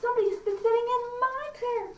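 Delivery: very high, indignant bear voice; middle-sized bear. Acted. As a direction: middle bear complaint, pitched right up. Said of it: "middle-sized bear also says".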